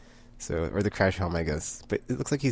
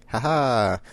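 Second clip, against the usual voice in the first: loud, cocky laughing taunt; gleeful mock taunt; 3 semitones higher, 5 dB louder, slower than usual. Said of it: "Petrichor, the transgender lady, is like".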